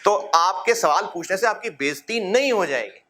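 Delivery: normal talking voice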